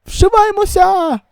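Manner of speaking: high, slow alarmed cry; panicked call to flee. Acted. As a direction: urge everyone to run. Fleeing the monsters. (панічний заклик тікати)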